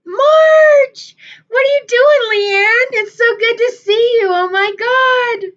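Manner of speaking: high, loud squeal of greeting; thrilled screaming hello to friends; excited shout. Real, not acted. Greeting friends joining the live.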